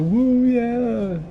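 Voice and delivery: quick high whoop; hype catchphrase. Retold or imitated. Quoting the catchphrase on purpose, high whoop. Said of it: "if there is some sort of a quote".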